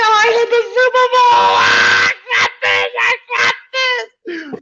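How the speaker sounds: high, loud whoop of joy; overjoyed shout. Retold or imitated. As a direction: shout it like you just hit the jackpot. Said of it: "You know, I got happy".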